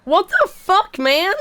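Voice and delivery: loud outraged "what the fuck"; indignant shout. Real, not acted. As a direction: yell back in disbelief. Yelling at rude game captain.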